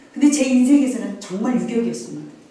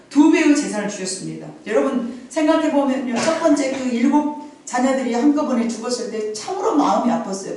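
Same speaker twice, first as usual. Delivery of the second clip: loud, raised preaching voice; full-force preaching; 4 semitones higher, 4 dB louder than usual. Acted. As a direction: proclaim it to the congregation. Preaching to the congregation. (힘찬 설교 톤)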